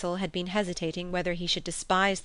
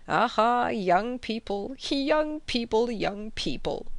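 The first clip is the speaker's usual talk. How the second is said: slow, drawn-out sing-song teasing; knowing tease; 5 semitones higher, slower than usual. A narrator's reading. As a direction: beam knowingly and stretch each word. Beaming, teasing the couple.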